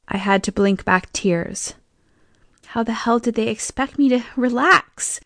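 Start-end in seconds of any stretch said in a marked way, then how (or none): none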